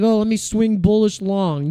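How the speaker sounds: put-on dopey trader voice; mock the gullible bull he won't be; mocking naive trader. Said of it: "I'm not gonna be like".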